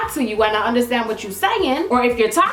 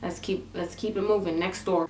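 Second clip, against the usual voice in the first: low, soft acted-out brush-off; re-enacted subdued, evasive murmur to mother; hushed urging; 5 semitones lower, 8 dB quieter than usual. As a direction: keep it down and steer mom along. Retold hushing of mother, hurrying on.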